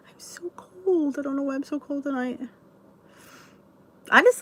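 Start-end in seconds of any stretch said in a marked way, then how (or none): 0.1-2.4 breathy hush, barely there; cold whisper. Real, not acted. Whispering to viewers about being cold.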